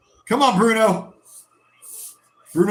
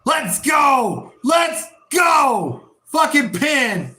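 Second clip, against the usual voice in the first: excited shout; 8 semitones higher, slower than usual. Real, not acted. Fan celebrating the pin call, high excited shouting. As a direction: roar it out in triumph.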